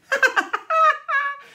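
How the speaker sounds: high, loud jeering laughter; mocking group laugh. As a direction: cackle at the fallen boy. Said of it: "Laughed Luther's followers".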